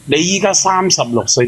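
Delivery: ordinary delivery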